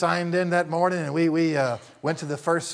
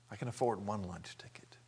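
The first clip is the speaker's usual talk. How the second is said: whispered; 6 semitones lower, 12 dB quieter than usual. Retold or imitated. Admitting he is nearly broke.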